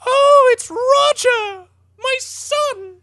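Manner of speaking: very high, slow, wailing mother voice; drawn-out high mother's cry of hope; desperate mother. Retold or imitated. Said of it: "she's just like".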